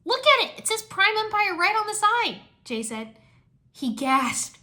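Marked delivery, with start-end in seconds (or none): 0.0-2.4 excited. Acted. Excited fan.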